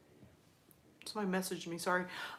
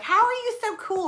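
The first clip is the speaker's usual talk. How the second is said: put-on high-pitched kid's question; mock kid voice, high; imitating an amazed kid; 12 semitones higher than usual. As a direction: pitch way up like an impressed child. Acting out her kid's amazed question.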